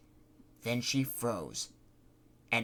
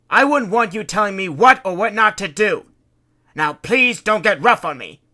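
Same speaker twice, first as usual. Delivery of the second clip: stern scolding; 9 semitones higher, 15 dB louder than usual. Acted. Fred scolds Kitty.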